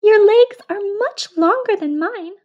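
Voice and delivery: very high squeaky mouse; tiny mouse voice. Acted. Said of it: "said the mouse".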